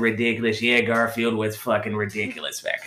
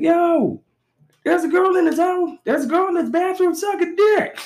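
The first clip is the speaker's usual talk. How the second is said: much higher, yelling the news down the hall; excited announcing dude. Retold or imitated. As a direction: herald it like the best news ever.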